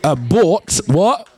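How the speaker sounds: Abort what? drawn out slowly, rising on what; stressed question. Shown as a demonstration. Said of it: "Watch this".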